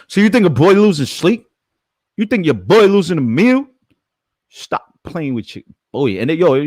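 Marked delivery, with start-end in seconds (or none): 0.1-3.7 high, loud mocking taunt at the haters. Taunting haters online with a high loud jeer. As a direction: pitch it up and jeer.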